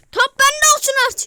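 loud, high yell; frustrated shout. Real, not acted. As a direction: yell for the ball. Topu isteyen bağırış. (topu isteyen öfkeli bağırış)

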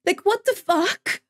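high, incredulous exasperation; pissed off. Real, not acted. Streamer fed up with the goalie's mistake, high exasperated anger. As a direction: pitch it up in angry disbelief. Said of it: "I'm so pissed".

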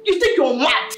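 ordinary talk, nothing marked

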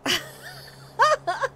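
rire. Real, not acted. Rit encore.

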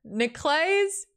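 high, slow exasperated name. Real, not acted. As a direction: groan out the name.

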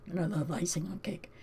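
soft breathy whisper; whispered aside. Real, not acted. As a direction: whisper it like a little secret. Whispered aside about loving icing.

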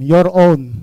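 slow, weighty "your own"; stress on your own. Shown as a demonstration. Preacher to congregation, stressing "your own" slowly. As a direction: lean on "your own", drawn out. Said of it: "I want to emphasize the word your own".

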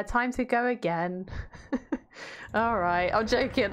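usual speaking voice

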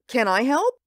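normal talking voice

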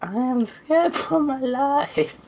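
squeaky high mock terror, slow; mock scared squeak. Gamer pretending fear in a creepy house, high mock squeak. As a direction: whimper it like a terrified cartoon. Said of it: "I'm crying".

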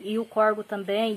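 ordinary talk

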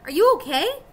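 worried call. A narrator's reading. Annie runs to fallen Jack.

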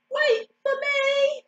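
high, slow, drawn-out plea; crying out. A narrator's reading. Ugly duckling stuck behind, crying out. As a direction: stretch out a squeaky call. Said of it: "cried the ugly duckling".

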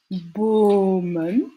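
"bomen" with the O held long; long vowel. Shown as a demonstration. Teacher giving the Dutch word for trees, long vowel drawn out. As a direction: stretch out the first vowel of "bomen". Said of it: "comme dans celui qu'on vient d'entendre".

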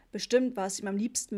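ordinary delivery, nothing marked

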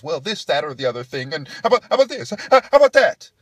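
mock excuses climbing to a high yell; excuse-making trainer. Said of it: "starts making excuses".

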